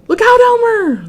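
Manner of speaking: high, loud call of alarm; warning shout. A narrator's reading. Birds calling a warning.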